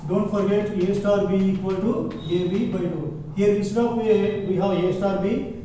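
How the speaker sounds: ordinary delivery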